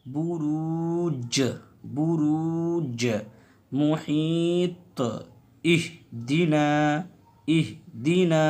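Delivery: slow recitation. Shown as a demonstration. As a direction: chant each word slowly. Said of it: "मेरे पढ़ने में".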